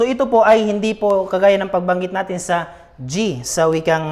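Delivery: ordinary delivery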